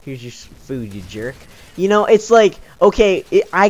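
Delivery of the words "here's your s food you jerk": much softer, lower, grudging fake politeness; fake-smile mutter. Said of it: "I cracked that fake smile".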